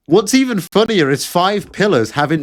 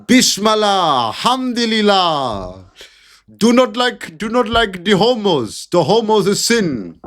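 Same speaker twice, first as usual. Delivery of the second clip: higher, put-on voice intoning the Arabic phrases; hammed-up pious disapproval, raised pitch; 6 semitones higher than usual. Mocking Muslims condemning gays, hammed-up preacher voice. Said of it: "And the Muslims are saying".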